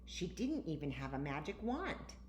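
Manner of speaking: ordinary delivery, nothing marked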